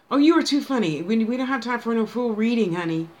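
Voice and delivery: loud, high burst of amusement; amused exclamation. Real, not acted. Reader teasing a viewer who wants a full reading, amused exclamation.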